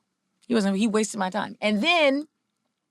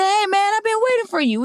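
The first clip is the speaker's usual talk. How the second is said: high, louder, eager greeting; high excited retold greeting; 13 semitones higher, 4 dB louder than usual. Speaker greeting the UPS guy he waited for, high and eager.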